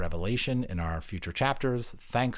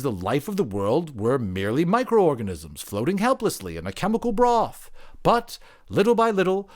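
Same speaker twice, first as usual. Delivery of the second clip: higher, louder, building tale; creature storyteller; 9 semitones higher, 5 dB louder than usual. Acted. Creature telling its story to the anthropologist, higher and building. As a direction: build it toward the climax.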